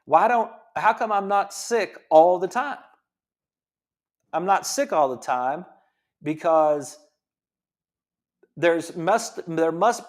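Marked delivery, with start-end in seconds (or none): none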